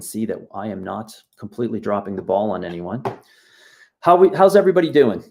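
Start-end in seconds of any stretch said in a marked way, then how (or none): 4.0-5.2 loud, quick, high call to the chat; excited greeting. Real, not acted. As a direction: rush out a cheery hello to everyone.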